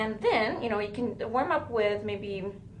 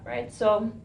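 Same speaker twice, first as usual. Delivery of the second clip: normal talking voice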